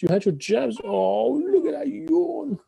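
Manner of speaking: high, drawn-out doting coo; puppy voice. Real, not acted. Owner to yawning puppy after jabs, in puppy voice.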